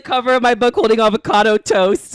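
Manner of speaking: loud, high indignant protest; mock outrage. Real, not acted. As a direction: exclaim it like you're offended. Mock outraged defense of cookbook.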